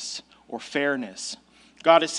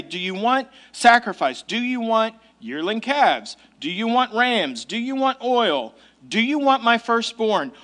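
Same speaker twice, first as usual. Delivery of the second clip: earnest, measured offers, each falling at the end; genuine; 4 semitones higher than usual. Shown as a demonstration. Preacher voicing the people's offer to God, genuine and earnest. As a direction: sincerely offer one thing after another, without sarcasm. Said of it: "They're being very genuine".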